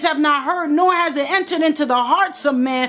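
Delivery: high, ringing preacher's cry; preaching at full force. Acted. Preacher urging Queen to claim her worth, full force. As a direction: preach it up to the rafters.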